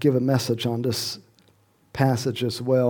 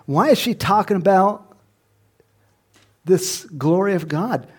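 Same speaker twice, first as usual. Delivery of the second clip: normal talking voice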